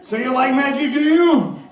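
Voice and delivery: loud, high carnival barker patter; a magician hyping up the audience, loud and high; magician showman. Acted. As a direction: tease the crowd like a stage magician. Showman teasing the crowd.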